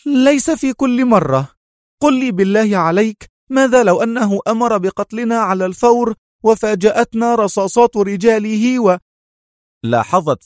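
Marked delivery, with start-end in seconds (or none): 0.1-9.0 higher woman's voice, louder and indignant; raised indignant outburst; indignant exclaim. A narrator's reading. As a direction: protest in exasperation. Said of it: "هتفت في سخط".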